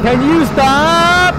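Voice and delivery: high, slow frightened shriek; scared shriek. Real, not acted. Sudden scare in game.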